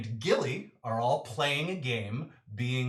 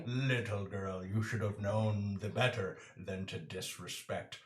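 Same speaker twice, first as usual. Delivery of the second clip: gloating Komodo King; 6 semitones lower, 6 dB louder than usual. Acted. Villain Gary gloats at Fern.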